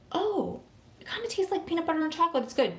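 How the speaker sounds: high-pitched, quick retold taste reaction; mock-naive wonder. Vlogger retelling how out of it she was over creamer, mock-naive wonder. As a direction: put on a high voice of silly discovery.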